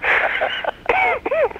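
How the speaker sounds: high-pitched real laugh; real high laughter. Real, not acted. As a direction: burst out laughing at a punchline. Laugh after the punchline.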